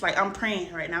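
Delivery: usual speaking voice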